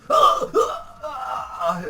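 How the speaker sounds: mock panicked yelps. Imitating him falling out, high panicked yelps. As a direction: squeal three silly panicked yelps. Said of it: "But instead he goes".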